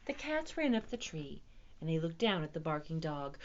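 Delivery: ordinary delivery, nothing marked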